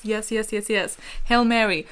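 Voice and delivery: normal talking voice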